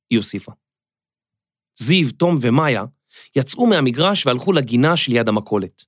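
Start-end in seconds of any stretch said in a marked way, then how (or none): none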